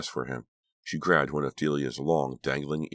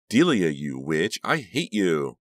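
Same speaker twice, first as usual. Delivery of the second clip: slow, loud, hateful outburst; furious accusation; 3 semitones higher, 4 dB louder, slower than usual. A narrator's reading. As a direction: spit out the accusation with hatred. Acted furious confrontation in story.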